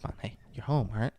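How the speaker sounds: very soft, uncomfortably intimate mock reassurance; whispered under the table to unsettle; creepy hushed whisper. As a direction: whisper it close, coaxing. Creepy uncle murmurs under the table.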